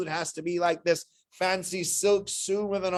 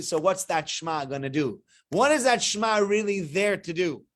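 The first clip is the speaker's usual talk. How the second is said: normal talking voice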